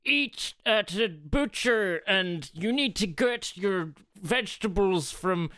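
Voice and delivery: mock snooty know-it-all. Mocking preachy shoppers.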